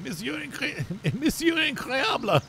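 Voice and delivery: high, slow, laughing mock French announcer; Incredibles French guy. Recalling the French guy from The Incredibles, high mock French. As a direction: stretch the name out like a grand French host. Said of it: "the French guy".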